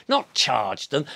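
ordinary talk

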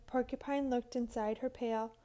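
ordinary delivery

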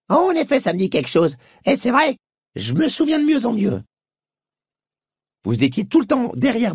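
high, careful voice of a puzzled horse; cautious horse. A narrator's reading. Horse perks up at oats. (Fraise, cheval prudent, voix aiguë)

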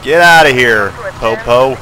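loud, dismissive shout; yelling at police. Real, not acted. Player yells at the game police, loud dismissal. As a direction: shout them away.

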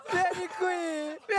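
high, slow sing-song call; calling from far away. Real, not acted. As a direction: draw out the call across the room. Chiama l'allenatore dopo la vittoria. (chiamare da lontano cantilenando)

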